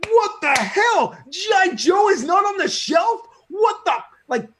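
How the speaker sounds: high, loud, outraged yelling; furious retold rant, high and loud; incensed shout. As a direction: blow up in disbelief at the empty shelf. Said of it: "I'm like".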